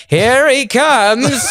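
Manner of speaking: high, very drawn-out mock announcer; stage introduction. Comic mocking how his friend brings him up, drawn-out emcee. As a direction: stretch it out like a hammed-up emcee. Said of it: "and you bring me up".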